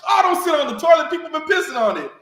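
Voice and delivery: very high, fast panicked yell; frantic warning shout. Retold or imitated. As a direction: shout the warning before he sits. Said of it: "And Sergeant Santiago's like".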